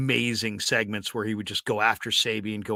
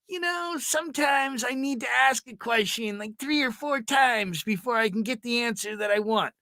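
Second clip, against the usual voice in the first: much higher mock Ralph voice; imitating Ralph; 10 semitones higher than usual. Ralph defending long interviews to the new boss, mock high voice. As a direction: pitch Ralph up, innocent and matter-of-fact.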